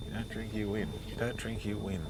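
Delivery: quiet haunting refrain; fading echo. Retold or imitated. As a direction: let the refrain recede in the head. Memory voice fading.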